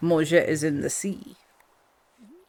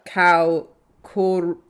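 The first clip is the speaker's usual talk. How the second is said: ordinary talk, nothing marked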